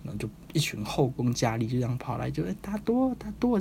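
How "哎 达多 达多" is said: high, coaxing mock-seductive voice; much higher playful coo; flirty maidens' call. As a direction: call his name like teasing temptresses.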